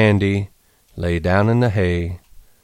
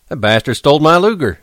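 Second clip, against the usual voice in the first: higher, louder, fast shout; 7 semitones higher, 4 dB louder, faster than usual. A narrator's reading. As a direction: burst in yelling. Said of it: "he shouted".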